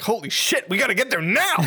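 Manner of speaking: high-pitched panicked exclamation; mock urgency. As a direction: cry out as if late for tea. Acted panic over five minutes left.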